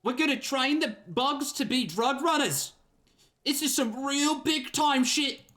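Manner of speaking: put-on high, slow, gleeful Australian-tinged kid voice; drawn-out cocky kid voice with Australian vowels; Kuno with an Australian twang. Player voicing Kuno to the detective, high Australian-sounding kid voice. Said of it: "Why are you making them Australian?".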